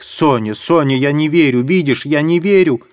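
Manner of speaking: high, fervent cry. Acted. Katerina embracing Sonya, fervent high cry. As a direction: insist on her faith in Sonya. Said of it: "кричала".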